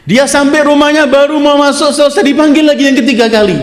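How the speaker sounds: fervent story preaching. Acted. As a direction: swear it with rising urgency.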